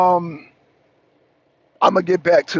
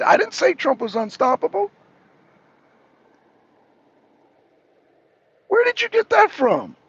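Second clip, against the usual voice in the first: irritated, raised voice at a YouTube commenter; exasperated rebuttal; 7 semitones higher than usual. Real, not acted. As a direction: push back hard at the commenter.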